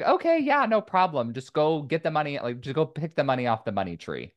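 high, mock-agreeable sarcasm, easing down; sarcastic mom. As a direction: imitate mom's sarcastic go-ahead. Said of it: "she'd say it very sarcastically".

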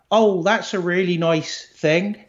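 put-on lightly done country Isle of Wight accent. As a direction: lilt it like an Isle of Wight local. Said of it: "from like".

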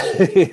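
a quick high laugh; short real chuckle. Real, not acted. Laughs before next story.

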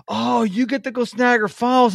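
high, sing-song imitation of an impressed friend; lightly playing someone gushing over the falls; mock envious friend. Host mimics people envying his Niagara trip, mock-impressed and high.

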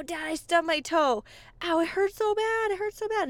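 put-on high, whimpering kid crying about his toe; whiny little boy. Little boy whining to his dad about his stubbed toe, high and pained. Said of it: "commercial where this little boy stubs his toe".

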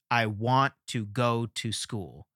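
slow "I want to go to school", stressing "want", "go", "school"; measured meter demo, "to" left unstressed beside "want", "go", "school"; three emphasized syllables. Shown as a demonstration. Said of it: "Three emphasized syllables".

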